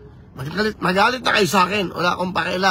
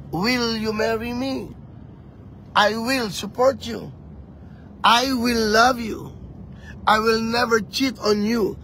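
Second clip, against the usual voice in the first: mock proposal; 3 semitones higher, slower than usual. Acts out a marriage proposal.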